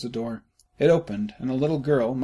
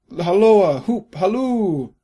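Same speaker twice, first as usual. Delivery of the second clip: much higher, louder, slow hunting call; loud halloo call; 9 semitones higher, 10 dB louder, slower than usual. A narrator's reading. Scrooge hallooing after Friday, loud call. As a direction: hoot after him across the field.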